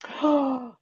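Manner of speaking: sharp real gasp of surprise; startled gasp. Real, not acted. Told by her listener that her mic is off, a startled gasp. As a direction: catch your breath in shock.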